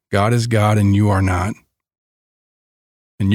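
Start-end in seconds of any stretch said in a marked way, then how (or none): none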